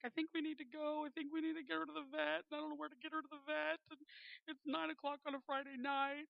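high, hurried mock-tearful plea; retold tearful worry. Crying wife pleads to go to the vet, retold tearful worry. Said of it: "and I'm crying".

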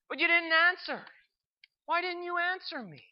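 high, plaintive questioning; sad. Acted. Man unanswered by God, sad high-pitched plea. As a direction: plead sadly, pitch lifted in hurt. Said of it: "The man was sad when he said this in his voice".

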